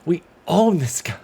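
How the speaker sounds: gloating cheer. Real, not acted. Gloating after the triple.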